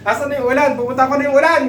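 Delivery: ordinary delivery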